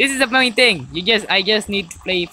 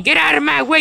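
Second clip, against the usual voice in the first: raised yell at another driver; angry shout; 7 semitones higher, 3 dB louder, faster than usual. Real, not acted. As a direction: bark it out the window. Yelling at traffic while driving.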